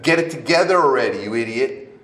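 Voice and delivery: acted-out fed-up rant; exasperated scolding. Speaker voices what you think at the stuck guy, exasperated.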